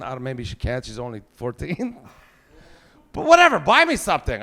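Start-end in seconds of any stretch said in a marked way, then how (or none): 3.1-4.4 put-on much higher, louder childish demand; dad demanding a present; whiny demand. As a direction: beg for a gift like a spoiled kid.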